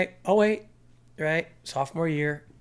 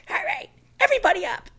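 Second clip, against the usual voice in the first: angry coach; 6 dB louder, faster than usual. Retold or imitated. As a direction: coach barking the team up, high and loud. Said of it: "and his Paul's like".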